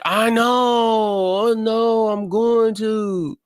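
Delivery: put-on high whiny kid voice, stretched out; sleepy daughter. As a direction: whine like a drowsy kid. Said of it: "She's like".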